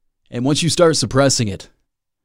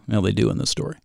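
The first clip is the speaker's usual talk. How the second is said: ordinary talk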